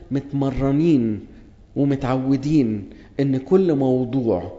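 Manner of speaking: ordinary talk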